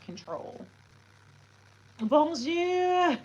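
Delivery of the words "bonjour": high, loud singsong Bonjour; excited greeting. Real, not acted. Streamer greeting a new viewer, high and loud. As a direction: greet the chat brightly with a lifted Bonjour.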